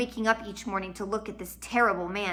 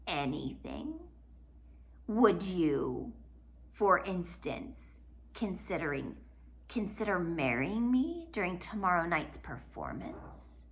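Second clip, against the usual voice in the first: softer, slow scheming villain; sly murmur; 6 dB quieter than usual. Acted. Villain leaning close to the girl, soft sly proposal. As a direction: lean in and purr the proposal.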